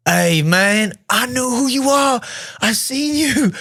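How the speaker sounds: imitating a starstruck Texan, very high-pitched; hammed-up excited Southern fan squeal; freaking-out fan. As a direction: gush in disbelief at meeting your idol. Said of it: "He's freaking out".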